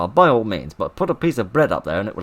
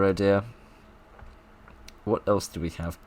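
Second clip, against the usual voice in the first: ordinary delivery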